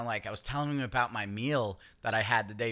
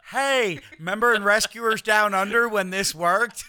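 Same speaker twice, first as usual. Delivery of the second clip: giggling through a jokey cartoon-line quote, pitched up and loud; laughing mock quote; 8 semitones higher, 8 dB louder than usual. Joking about the dead stowaway, laughing loud and high. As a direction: crack up while mocking him. Mocking the man in the gear.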